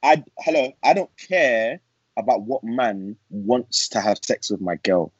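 ordinary delivery

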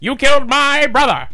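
loud, high gangster outburst; hammed-up movie tough guy; James Cagney. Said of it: "You want James Cagney?".

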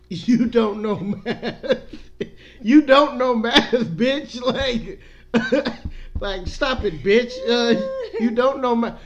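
loud, high, cracking up between words; laughing mockery. Real, not acted. Host roasts people counting days to Christmas, laughing hard. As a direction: shout it through helpless laughter.

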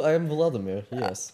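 put-on Russian accent with halting delivery. Podcast host welcoming listeners as Vladimir, Russian accent. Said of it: "gonna do this accent the entire time".

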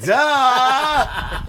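very high, loud cartoonish duh; goofy squeal of a comic; a comedian mocking the obvious; Jerry Lewis impression. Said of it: "he's like".

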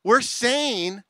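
ordinary talk

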